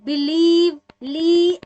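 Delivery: बिलीव with the ली stretched into a long ee; long ee sound. Shown as a demonstration. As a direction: draw out ली in बिलीव. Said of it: "अब बोलने में कैसे आ रहा?". (लंबी ई के साथ बिलीव)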